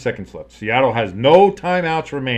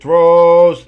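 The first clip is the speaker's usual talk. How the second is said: excited call; 5 semitones higher, 13 dB louder than usual. Real, not acted. Live play-by-play pass call.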